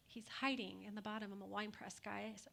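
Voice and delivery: fast hushed whisper to the room; whispered aside. Real, not acted. Preacher whispers to the congregation about Gideon hiding. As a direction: lean in and whisper it.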